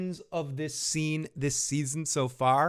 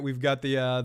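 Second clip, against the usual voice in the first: ordinary talk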